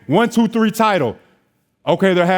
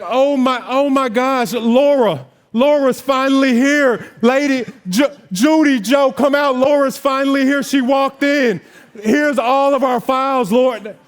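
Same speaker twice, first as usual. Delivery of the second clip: imitated excited greeting; 6 semitones higher than usual. Title office staff greeting him, mock excited. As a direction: play the office delighted he arrived.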